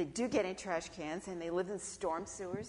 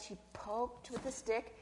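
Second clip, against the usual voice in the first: ordinary delivery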